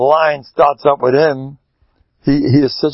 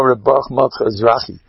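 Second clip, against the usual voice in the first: ordinary talk, nothing marked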